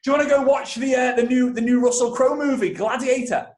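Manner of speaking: high, loud excited dad voice; bursting in excited. Retold or imitated. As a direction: burst in with big, eager news. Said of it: "he comes bursting in".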